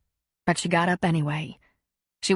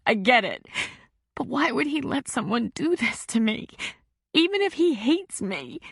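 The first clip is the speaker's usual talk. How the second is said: a hint of a hurt teen pouring it out; a distraught girl describes the leaked picture to a counselor; upset teenage girl; 3 semitones higher than usual. A narrator's reading. Teen girl voiced in story.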